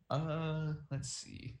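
usual speaking voice

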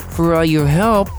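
usual speaking voice

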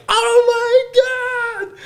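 high, slow, loud stretched-out disbelief; drawn-out laughing oh my God. Retold or imitated. Shooter cracking up at Mo's answer, drawn out high. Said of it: "It's like".

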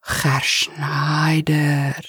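put-on slow, whispered, half-begging plea in Spanish accent; begging or angry. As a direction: stretch his name out, pleading and cross. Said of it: "almost in a begging tone or, or angry".

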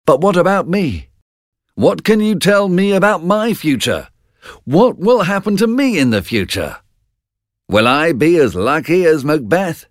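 lightly voiced curious friend asking about his future; curious Banquo. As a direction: press the witches for his own fortune. Banquo eagerly questions witches.

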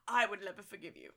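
low, grave quote; lightly voiced solemn father; film father. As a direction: quietly lay down the threat. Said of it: "Doesn't he say, like".

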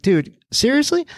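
high-pitched disbelieving protest; put-upon parent, voice shooting up; mock exasperation. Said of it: "it sounds infuriating".

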